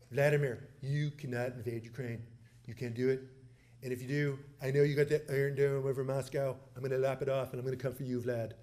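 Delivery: low-pitched presidential impression; Donald Trump. President retelling his warning to the Russian leader, impersonated. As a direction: play the former president warning off a rival leader. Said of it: "I'll do it in his voice".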